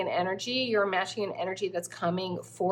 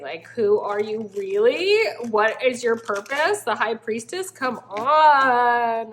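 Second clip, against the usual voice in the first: excited wonder; 2 semitones higher, 6 dB louder, slower than usual. Real, not acted. Excited about the cards.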